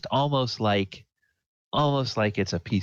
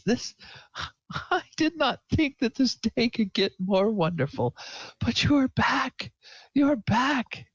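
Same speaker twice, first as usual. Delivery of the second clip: high, laughing through happy tears; laughing with joy. Acted. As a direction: marvel that he is back, laughing as you go. Throry tearful with joy.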